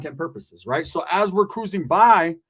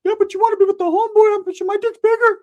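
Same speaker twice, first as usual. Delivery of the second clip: much higher, louder put-on voice pleading with her; a silly high imitation of Ricky hitting on her; mocking Ricky; 8 dB louder than usual. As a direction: whine it way up high like a lovesick fool. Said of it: "trying to spit game".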